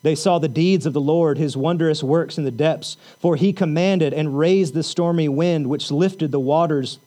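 solemn reading of the psalm; scripture reading. Acted. Reciting psalm over music.

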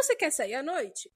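normal talking voice

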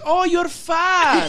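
high, loud, slow mock Romanian accent; imitated Romanian woman. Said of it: "And she goes".

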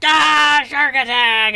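showboating wrestler battle cry; Shark Attack. Acted. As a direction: bellow your own name at the crowd. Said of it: "he's like".

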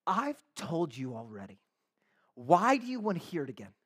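mildly cheeky, exasperated retort; a little exasperated. Acted. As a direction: push back at being asked twice. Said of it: "a little bit exasperated".

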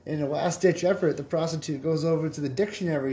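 ordinary delivery

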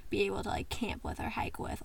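whispering. Real, not acted. Voice drops to whisper.

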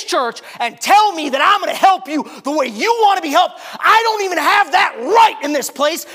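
high loud preacher's roar; preaching rebuke. Retold or imitated. Pastor rebuking the man in his office, roaring. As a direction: thunder it like a fiery sermon.

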